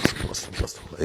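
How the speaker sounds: whispered repetition of the prayer; soft whispered glorification; low-voice prayer. Shown as a demonstration. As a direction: murmur it under your breath. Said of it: "بس بصوت واطي".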